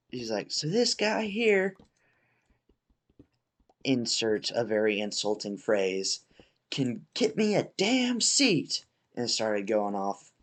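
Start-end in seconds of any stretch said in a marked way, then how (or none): 0.5-1.8 high-pitched mock rant; imitated ranting dad. Dad ranting about the host, imitated high yell. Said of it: "he's yelling and yelling and yelling". 6.7-8.9 high, slow mock yell; imitated ranting dad. Dad demanding a seat from host, imitated high yell. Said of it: "he's yelling and yelling and yelling".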